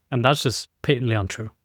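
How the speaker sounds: ordinary delivery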